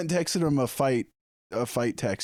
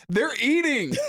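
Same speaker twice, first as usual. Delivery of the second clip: put-on kid's excited shout. Kids yelling at the neighbor's window, high excited kid voice. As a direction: squeal it like an eager trick-or-treater. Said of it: "and they're like".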